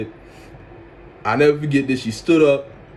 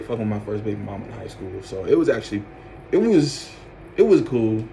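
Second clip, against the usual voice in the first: ordinary delivery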